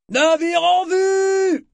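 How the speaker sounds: very high, long drawn-out hail; lookout's shout. A narrator's reading. Lookout warns the crew of a ship, shouting. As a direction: cry out an alarm from aloft, stretching it out. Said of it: "cria soudain l'homme de vigie".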